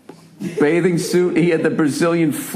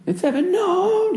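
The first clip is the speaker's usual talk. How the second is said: known sung much higher and slow; ends going up; slower than usual. Shown as a demonstration. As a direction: hold known and climb way up like the live version. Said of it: "that's how he sings it now".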